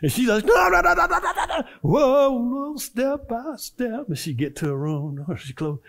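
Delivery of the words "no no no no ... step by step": very high, loud no-no-no; high mock protest. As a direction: squeal the protest. Mother protests, grandmother sings.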